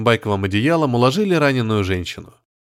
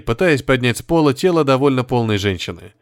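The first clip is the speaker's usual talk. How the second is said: ordinary delivery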